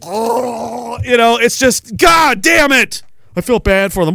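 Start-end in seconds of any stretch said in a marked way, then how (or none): 2.0-3.0 slow, higher frustrated yell; exasperated shout. Acted. Ranting to chat, slow shouted groan. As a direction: groan it out in disgust. Ranting about fat people.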